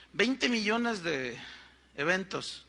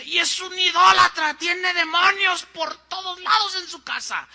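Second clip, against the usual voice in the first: high, loud accusing pulpit cry; angry preaching; 9 semitones higher, 7 dB louder than usual. Retold or imitated. Pastor accusing his host of idolatry from the pulpit, loud and high. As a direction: thunder the accusation like a fiery preacher. Pastor's sermon retold by leader.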